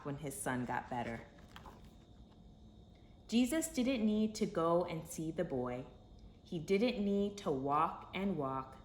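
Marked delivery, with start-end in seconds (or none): none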